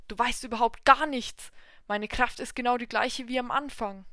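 put-on loud, defiant old man; shouting. God shouts back.

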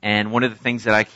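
ordinary talk

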